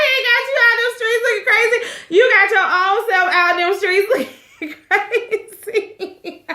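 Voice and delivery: high, fast laughing retort through tears of laughter; cracking up while repeating the wife's line. Real, not acted. Laughing at the wife's rant, repeating her words.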